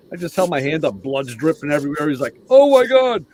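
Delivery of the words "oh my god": high, loud startled outcry; shocked gasp. Retold or imitated. Buddy seeing the bloody hand cries out in shock. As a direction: recoil at the sight of the blood.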